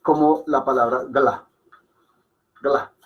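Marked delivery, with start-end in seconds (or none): 1.0-2.9 "gla" as one syllable, first vowel swallowed; Hindi pronunciation. Shown as a demonstration. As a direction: slide g straight into l in "gla". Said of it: "no suena tan en hindi como".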